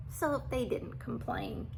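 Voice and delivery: ordinary delivery, nothing marked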